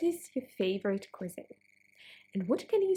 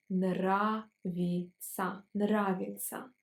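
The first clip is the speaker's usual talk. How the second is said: slow syllable drill; slower than usual. Shown as a demonstration. Teacher drilling students word by word, slowly. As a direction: slowly repeat each word twice. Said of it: "Let's pronounce it slower".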